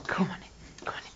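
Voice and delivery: breathy teasing invite with a laugh; a hushed come-on-in with a laugh; playful whisper. Real, not acted.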